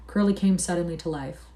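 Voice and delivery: ordinary delivery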